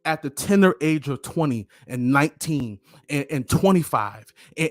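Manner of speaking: usual speaking voice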